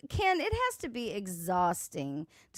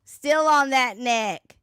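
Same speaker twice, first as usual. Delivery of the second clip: louder, defiant comeback; 2 semitones higher, 7 dB louder than usual. Real, not acted. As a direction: shout it back at her. Defiant reply to clip.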